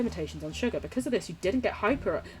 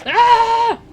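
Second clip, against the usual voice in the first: high loud shriek; sudden high alarmed cry; startled shriek; 14 dB louder than usual. Real, not acted. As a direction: yelp as the boat breaks. Game boat breaks.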